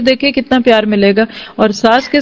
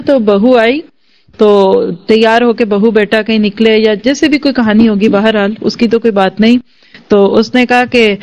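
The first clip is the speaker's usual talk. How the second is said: ordinary talk, nothing marked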